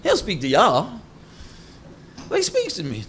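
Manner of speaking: hammed-up self-important believer; over-righteous persona. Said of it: "we get over-righteous".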